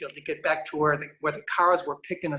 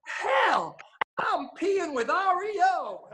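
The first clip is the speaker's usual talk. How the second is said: high, drawn-out excited holler; imitated fan's yell; 13 semitones higher, slower than usual. Bandmate retelling a fan's bathroom outburst, imitated high yell. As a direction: mimic a starstruck fan hollering, voice way up. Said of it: "yells out in the middle of this bathroom".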